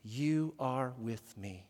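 soft, low and very slow; measured, low call for repetition; hushed lead-in phrase. Shown as a demonstration. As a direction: quietly give the phrase to be repeated, word by word. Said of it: "and then you repeat after me".

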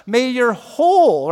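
whole loud and slowed; stress on whole. Shown as a demonstration. Pastor pausing on the word whole, loud. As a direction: hit whole hard. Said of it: "we'll stop right there".

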